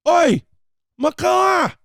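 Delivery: put-on as if walking in on a baby riding the dog; loud alarmed "what happened?"; shocked outburst. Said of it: "you would come in and go".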